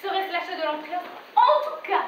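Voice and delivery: high, irritated complaint; exasperated outburst. Acted. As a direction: blow up in high-pitched exasperation. Acted quarrel between friends over a purchase. (exaspération, voix montée dans l'aigu)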